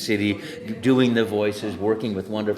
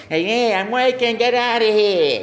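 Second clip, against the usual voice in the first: angry Brooklyn plumber; 12 semitones higher, 8 dB louder than usual. Retold or imitated. Actor trying a yelling Brooklyn plumber voice. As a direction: shout them off in Brooklyn style. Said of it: "Italian plumber from Brooklyn".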